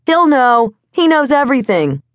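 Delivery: high-pitched shout over the shoulder; calling back. A narrator's reading. Kid riding away to woman, high calling back. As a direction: keep calling back as you move off. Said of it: "he called back over his shoulder".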